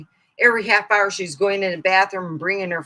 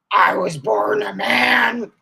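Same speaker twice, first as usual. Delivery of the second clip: imitating sibling; 2 semitones higher, 5 dB louder, slower than usual. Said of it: "he goes".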